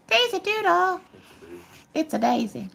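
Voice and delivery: high, soft coo at the dog; sweet pet voice for Daisy. Real, not acted. Cooing at the dog.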